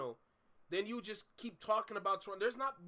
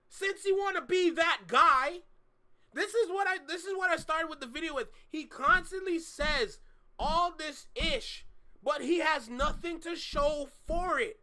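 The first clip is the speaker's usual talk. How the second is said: heated rant; 4 semitones higher, 6 dB louder than usual. Real, not acted.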